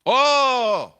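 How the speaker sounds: loud, high mock-gruff "oh"; curt retort. Husband greeting an unwelcome pastor, curt and loud. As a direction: imitate a husband's loud, scornful reception. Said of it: "mau, mau ketus".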